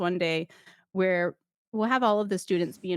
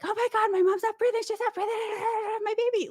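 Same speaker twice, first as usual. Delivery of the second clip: high-pitched mock-hysterical cry; panicked relative; faster than usual. Instructor playing a panicked relative for trainees, mock hysteria. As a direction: act out a frantic parent, voice way up. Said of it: "they kinda get that real world".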